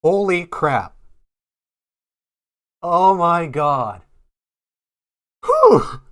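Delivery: stunned shout of delight; excited. Real, not acted. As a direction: blurt it out, thrilled. Big card pull reaction.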